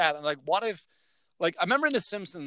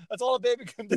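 ordinary talk, nothing marked

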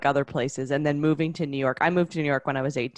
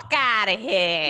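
slow, mock aggressive New Yorker; a light tough-guy New York snarl; New York accent; 5 semitones higher, slower than usual. Imitating how everyone does the New York accent, mock aggressive. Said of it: "it's always like".

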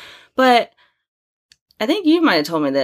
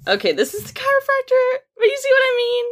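excited; faster than usual. Real, not acted. Showing chiropractor photo excitedly.

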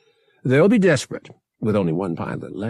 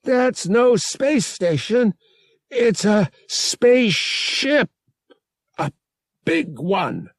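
high, urgent warning; suddenly urgent; 12 semitones higher, 5 dB louder than usual. A narrator's reading. Passenger warning Han of a big ship, suddenly urgent. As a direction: press the warning home, pitched high. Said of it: "Morav's voice was suddenly urgent".

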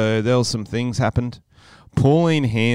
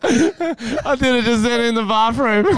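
prank reveal laughing; 13 semitones higher than usual. Real, not acted. Reveals his undies prank.

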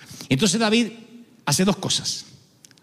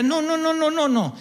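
mock refusal; 7 semitones higher than usual. Pastor refusing an offering.

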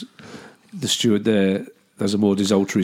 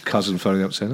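usual speaking voice

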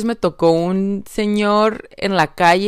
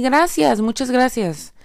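higher, firm gracias; simple confident thank-you; gracious thanks; 6 semitones higher than usual. Shown as a demonstration. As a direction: say it clean and gladly. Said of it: "No, di".

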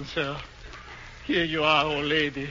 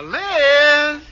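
louder, higher drawn-out call; 10 semitones higher, 6 dB louder than usual. Acted. Husband calls for his wife Liz, calling out. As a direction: raise the voice to summon her.

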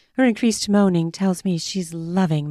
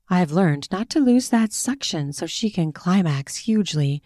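ordinary delivery

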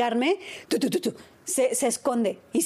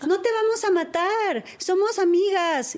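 put-on higher, slow childlike calling; child calling to spider; 6 semitones higher, slower than usual. Daughter calling kindly to the hidden spider, higher and slow. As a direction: sweetly reassure the spider like a small girl. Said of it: "le empieza a decir".

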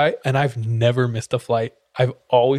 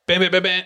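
high mock beeping of a ticket scanner; scanner beep imitation; 6 semitones higher, faster than usual. Mimicking the gate scanner rejecting his ticket, high beeps.

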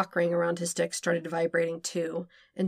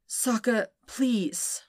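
pleading; 5 semitones higher, much slower than usual. Acted. Zuko begging to stop.

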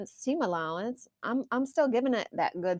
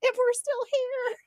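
laughing near tears; 14 semitones higher than usual. Real, not acted. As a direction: squeak it out laughing.

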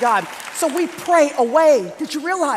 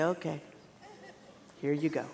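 very low, hushed compliance; disciples handing over; 11 semitones lower, 16 dB quieter than usual. Retold or imitated. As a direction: murmur it low, imitating the disciples. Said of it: "They say".

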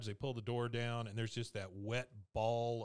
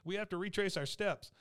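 high, quick panicked call to her son; urgent mother; 8 semitones higher than usual. Retold or imitated. Mom rushing Bobby to go find the lost flag, high and urgent. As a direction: hurry him back into the truck, voice up high. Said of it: "and she says".

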